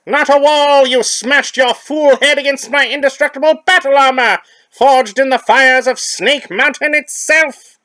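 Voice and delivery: high, loud cackling villain voice; shrieking skeleton villain; villain gloat. Acted.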